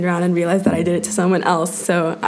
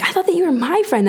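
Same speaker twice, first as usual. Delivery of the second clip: mimicking a hurt friend; 10 semitones higher, faster than usual. Friends feeling betrayed, quoted.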